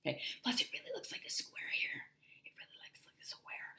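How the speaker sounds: whispered aside. Real, not acted. Teacher confiding about the shape, whispered. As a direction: breathe it almost silently.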